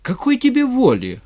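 high, loud indignant outburst; flaring up. A narrator's reading. Styr as tsar answering a plea for freedom, flaring up. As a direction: flare up at the very idea. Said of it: "вскинулся Стырь".

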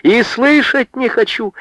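high, insistent refusal; high-pitched stubborn outcry; unrelenting protest. A narrator's reading. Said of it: "не унималась Анка".

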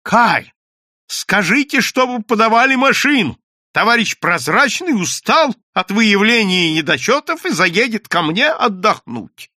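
high, loud order; calling out. A narrator's reading. Bookkeeper calling to the registrar, high and loud. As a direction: call over the noise. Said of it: "крикнул".

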